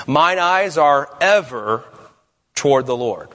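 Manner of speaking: "ever" stretched slowly, then a pause; stress on EVER. Shown as a demonstration. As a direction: land hard on "ever" before finishing the verse. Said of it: "place the emphasis on different".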